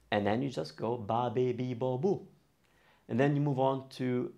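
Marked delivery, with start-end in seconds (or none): 1.0-2.2 "ba, be, bi, bo, bu" in an even row; open-vowel syllable drill. Shown as a demonstration. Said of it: "have all the vowels open".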